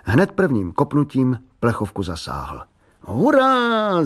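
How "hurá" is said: high, drawn-out hurrah; excited cheer. A narrator's reading. Boy cheering after hitting the can, excited cheer. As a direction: cry out a long, high cheer. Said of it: "zakřičel tak".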